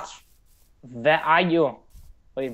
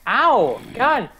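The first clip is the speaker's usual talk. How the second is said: ordinary talk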